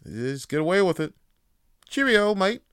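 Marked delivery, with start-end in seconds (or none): 1.9-2.6 loud, high, hammed-up British accent; mock British accent. Mocking British who get away with it, loud jaunty British accent. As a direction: bark a cheery British greeting in a jaunty British accent. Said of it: "'cause they have a British accent".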